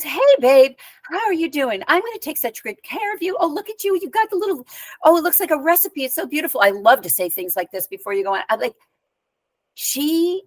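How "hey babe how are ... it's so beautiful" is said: high-pitched, effusive imitation of a doting doctor; imitated sugary fussing, pitched way up; suddenly gushing. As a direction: gush over a patient in a high, hurried coo. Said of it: "she changed".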